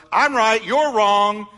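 hammed-up indignant certainty; self-righteous. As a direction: declare it as if God is on your side. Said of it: "My attitude was".

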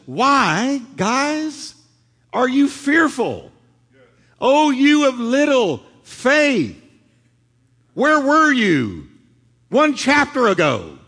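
slow rising plea to frightened friends; Jesus rebuking. Acted. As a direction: ask it like a disappointed teacher. Preacher voicing Jesus rebuking disciples.

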